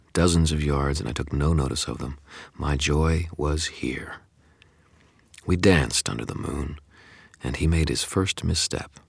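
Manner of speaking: ordinary delivery, nothing marked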